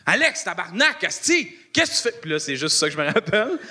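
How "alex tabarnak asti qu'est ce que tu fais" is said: rising mock outburst, pitch climbing much higher; retold angry swearing by the ex, pitched way up; ex's outburst. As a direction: imitate the ex losing it at you. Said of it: "puis il est comme genre".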